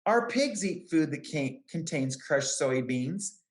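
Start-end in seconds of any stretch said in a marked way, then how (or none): none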